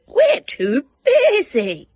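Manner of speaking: high loud brusque sheep; busy sheep. Acted. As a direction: bleat out an impatient refusal. Sheep brush him off.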